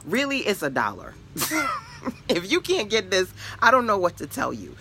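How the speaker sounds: can't believe they won't pay; amused exasperation. Acted. Coach mocking a buyer who won't spend a dollar, exasperated.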